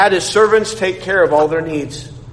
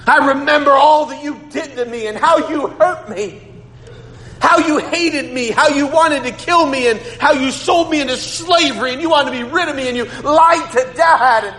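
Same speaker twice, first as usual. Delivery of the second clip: high, building to a shout; mock angry rant; 8 semitones higher than usual. Joseph berating his brothers in a pretend rant, rising to a shout. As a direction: pile on the accusations until you yell. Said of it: "He didn't say".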